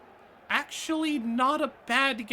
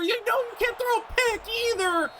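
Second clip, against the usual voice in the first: high-pitched outburst; 12 semitones higher than usual. Real, not acted. Gamer yelps at his quarterback, high and alarmed. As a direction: voice jumps high as the pass goes up.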